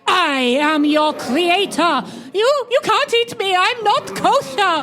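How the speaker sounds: terrified screaming. Acted. Being eaten by monster.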